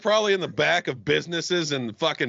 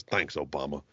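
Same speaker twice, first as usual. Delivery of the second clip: low, soft, deadpan "Thanks, Obama"; 10 semitones lower, 7 dB quieter than usual. Retold or imitated. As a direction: mutter it low and dry, resigned. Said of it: "and goes".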